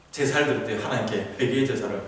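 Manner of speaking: normal talking voice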